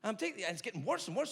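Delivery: ordinary delivery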